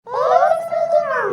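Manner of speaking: loud, slow, high cry of alarm; shocked shout. Acted. Student to teacher hearing of the parents meeting, shocked yell. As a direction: yell it out in horror, dragging it.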